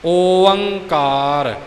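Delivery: ਓੰਕਾਰ stretched very slowly, every sound held; slow model pronunciation. Shown as a demonstration. Teacher shows learners ਓੰਕਾਰ, very slow and deliberate. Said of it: "ਲਕੀਰ ਨੂੰ ਕਾਰ ਕਿਹਾ ਜਾਂਦਾ ਹੈ".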